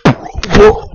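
sudden real startle; startled whoa. Real, not acted. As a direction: yelp it out as the stunt goes wrong. Startled by a stunt.

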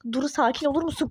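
usual speaking voice

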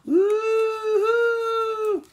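high, wild woo-hoo; excited whoop. Acted. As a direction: let it fly out like the wind. Fleeing back through the snowstorm.

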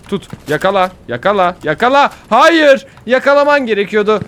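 building, loud high urging that peaks on Hayır; pleading shout. Real, not acted. Gamer begging his character to catch the ledge, rising pleading shout. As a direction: beg the character to grab, then cry out.

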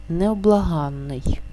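ordinary talk, nothing marked

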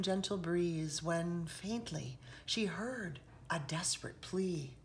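usual speaking voice

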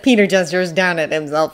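plain reading voice

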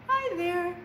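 high squeaky little goat voice; small billy goat. Acted. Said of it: "talks like this".

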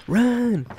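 high, loud panicked shout; panicked yell. Real, not acted. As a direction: scream a warning. Yelling at past self in clip.

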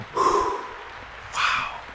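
long exhaled sigh; big sigh of relief. Real, not acted. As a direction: let out a huge relieved sigh. Just finished exam.

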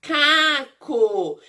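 "macaco" slowly as ma, ca, co; split into syllables. Shown as a demonstration. Teacher naming the little animal, "macaco" sounded out syllable by syllable. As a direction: separate and stretch each syllable of "macaco". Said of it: "ele começa com a sílaba".

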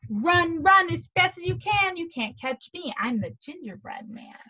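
high, loud start falling back to normal; lightly chanted gingerbread man's rhyme; sing-song taunt. Acted. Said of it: "he sang as I ran".

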